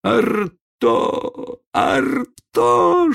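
very high, long pleading howl; plaintive wail. A narrator's reading. Old man wailing for his lost dog, high and pleading. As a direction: wail the dog's pet name. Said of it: "жалобно завыл старик".

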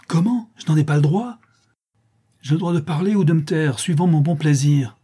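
high, loud indignant retort; outraged. A narrator's reading. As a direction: assert your right with offended authority. Countess outraged at being contradicted. (indignation hautaine et emportée)

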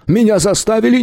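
high, scared protest; frightened plea. A narrator's reading. Shopkeeper pleading with the hamsters, high and scared.

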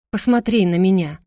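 plain reading voice

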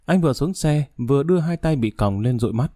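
plain reading voice

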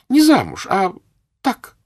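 very high, loud; girlish insistence. Acted. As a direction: daughter insisting to her mother. Daughter insists to mother.

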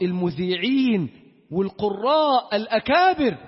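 ordinary talk

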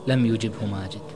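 usual speaking voice

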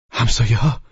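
high, worried question; anxious. A narrator's reading. Guest jumping at a knock on the door, anxious question. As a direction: ask it with a nervous lift. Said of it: "با دلواپسی پرسید".

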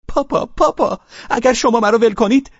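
put-on high loud child's plea; desperate pleading. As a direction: beg her father in panic. (التماس نومیدانهٔ دخترک)

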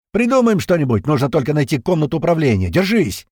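loud, high shout over engine. A narrator's reading. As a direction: yell it over the noise. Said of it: "и прокричал".

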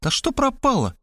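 high-pitched puzzled question; lightly voiced astonished woman; surprised. A narrator's reading. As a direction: ask in surprise, voice jumping up. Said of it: "удивилась Мархалёва".

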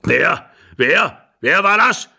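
put-on loud, startled old man; calling out. A narrator's reading. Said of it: "rief der Alte von der Tür her".

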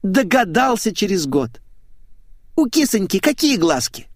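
put-on high-pitched girlish sarcasm; exasperated blonde. A narrator's reading. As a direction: needle him in a high girlish voice. Blonde mocks slow narrator.

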